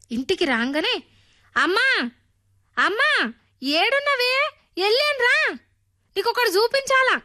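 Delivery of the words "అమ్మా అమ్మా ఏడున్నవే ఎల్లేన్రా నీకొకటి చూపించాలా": put-on eager child yelling for mom to come see; excited shout. A narrator's reading. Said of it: "అరుస్తాడు". (అమ్మను పిలుస్తూ ఉత్సాహంగా గట్టిగా అరుపు)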